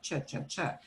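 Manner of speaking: short, quiet "cha, cha, cha"; "cha" repeated softly as a bare letter sound; demonstrated CH sound. Shown as a demonstration. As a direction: say "cha" as a clipped CH sound, three times over. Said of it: "it sounds like what you think it sounds like".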